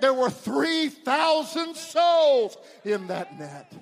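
high, loud, swelling pulpit delivery; ringing revival-preacher crescendo; full-force preaching. Real, not acted. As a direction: proclaim it in triumph, stretching the climax. Preaches triumphant climax.

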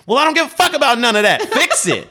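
loud, high, fast outburst; fed-up customer shouting a demand; irate yelling. Retold or imitated. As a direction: explode at the agent on the phone. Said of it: "I'm yelling because this is a messed up situation".

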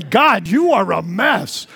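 slow, mock accusing jab at another person; hammed-up finger-pointing blame; pointing at somebody. Shown as a demonstration. Pastor mocking blaming a neighbour, slow mock accusation. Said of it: "don't look at somebody".

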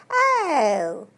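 silly upper-class English twit, high and loud; Mister Twit. Retold or imitated. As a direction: squeal it out like a dithering toff. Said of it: "Mister Twit looks up in the sky and says".

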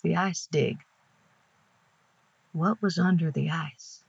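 ordinary delivery

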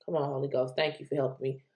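ordinary talk, nothing marked

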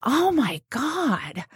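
soft, high, drawn-out dismay; moved reaction. Retold or imitated. Therapist reacting to a husband's plea, touched and astonished. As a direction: let it out slowly, high and hushed.

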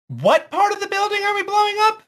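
very high, fast, cheery; eager question. Acted. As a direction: ask it bubbly and brightly. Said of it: "she sort of says".

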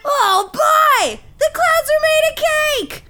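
high, excited exclamation; overjoyed, voice leaping way up; thrilled discovery. Acted. Dad realising clouds are cake, thrilled. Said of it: "he got so excited".

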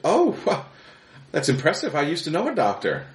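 high, sweet surprised wife; wife's voice. Acted. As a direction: lift the voice high and chirpy. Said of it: "She goes".

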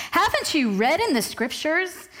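swooping, sing-song snarky question. Acted. Preacher voicing Jesus' reply to the Pharisees, snarky. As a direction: ask it with mock disbelief, pitch swooping up and down. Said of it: "I like to picture it in a snarky voice".

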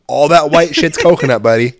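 normal talking voice

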